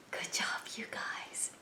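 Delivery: soft whispered good job; whispered praise. Acted. Host praising the kids in a whisper. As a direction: whisper it like a secret compliment.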